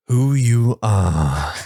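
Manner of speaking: "Who you are" drawn out very slowly, each word weighted; slow it right down and lean on "Who"; slow emphatic. Shown as a demonstration. Husband spelling out his philosophy, slow and weighty. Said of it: "Here's the thing".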